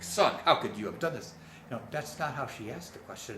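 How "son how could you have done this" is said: loud mock-scolding mother; haughty, accusing outburst; haughty reproach. Shown as a demonstration. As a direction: demand it like an affronted, reproachful mother. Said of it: "haughty and reproachful tone of voice".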